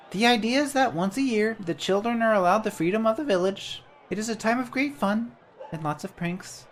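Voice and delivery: a tiny bird-sized helper, cheerful; chirpy little creature. Acted. Jan introduces himself to the traveller, high and chirpy.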